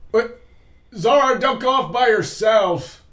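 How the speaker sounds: high, slow, pleading call; earnest in-character plea. Acted. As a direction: beg her not to wander off. Player speaks in character.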